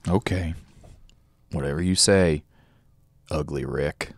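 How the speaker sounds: low, quiet reluctant mumble; begrudging. Acted. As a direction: give in grudgingly. Bartender begrudgingly concedes.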